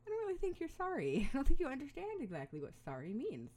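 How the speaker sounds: high, loud, incredulous retort with a laugh; skeptical parent. Retold or imitated. Parent answering a child's weak sorry, skeptical. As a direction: challenge the apology as an unconvinced parent.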